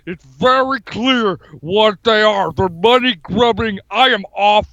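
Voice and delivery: hammed-up tough-guy politician impression; comic strongman bluster; Jesse Ventura. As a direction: declare it like a blustering off-grid candidate. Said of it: "This is Jesse".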